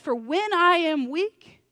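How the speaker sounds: loud, slow, high build on the verse; preacher's peak, slow and loud; rising preach. Acted. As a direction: lift it up and stretch it out. Preaching climax on scripture.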